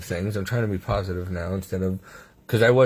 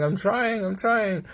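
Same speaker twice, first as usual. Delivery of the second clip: much higher, pleading re-enactment; retold strained protest; 11 semitones higher, slower than usual. Patient answering the team while fading, retold strained protest. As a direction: act out struggling to obey. Acting out his struggling reply.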